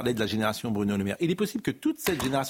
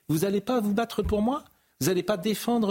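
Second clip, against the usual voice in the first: lightly acted leader's reproach, pitched higher; Emmanuel Macron reproaching; 7 semitones higher than usual. Playing the president scolding his own camp, mildly indignant. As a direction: press the questions like a let-down boss, mildly. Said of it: "si j'étais Emmanuel Macron".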